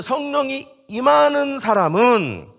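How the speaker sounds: loud, slow, high preaching; emphatic proclamation. Real, not acted. Preacher asking whom the Spirit comes upon, emphatic proclamation. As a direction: proclaim it with drawn-out force.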